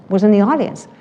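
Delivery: ordinary talk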